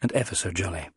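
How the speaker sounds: usual speaking voice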